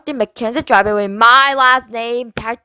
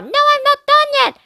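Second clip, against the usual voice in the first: put-on much higher, childish little sister voice; little sister chattering from the bathroom; little girl voice; 4 dB louder than usual. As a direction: play a carefree little girl. Brianna voiced.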